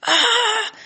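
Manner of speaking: high, loud cry of frustration; imitated yell of sheer frustration; frustrated yell. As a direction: let out an exasperated "ah". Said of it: "he was just so frust".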